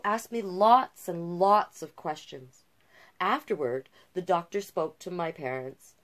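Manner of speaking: bright, lively read-aloud listing hobbies; picture book narration. A narrator's reading. Reader shares Jazz's hobbies, lively storybook narration.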